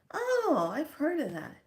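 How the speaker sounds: sudden jump up on oh; chirpy high reply; mock bright reply; high-pitched oh. Casual reply retold.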